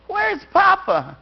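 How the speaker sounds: put-on high, slow little girl's question; child's question. A small girl asking the family where her papa is, high and slow. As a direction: ask it like a worried small child.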